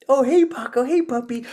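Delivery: very high-pitched greeting to a dog; puppy-talk voice. Shown as a demonstration. Owner greeting his dog Paco, high puppy-talk voice. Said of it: "voice to".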